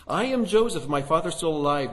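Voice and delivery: high and loud, a brother revealed; loud emotional cry. A narrator's reading. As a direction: proclaim it to stunned brothers. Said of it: "He cried in a loud voice".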